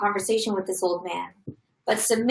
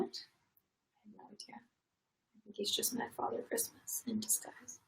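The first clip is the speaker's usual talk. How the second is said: hushed whispered aside to the co-reader; 18 dB quieter than usual. Real, not acted. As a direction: whisper the joke under your breath.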